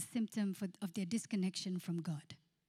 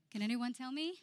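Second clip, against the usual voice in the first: ordinary talk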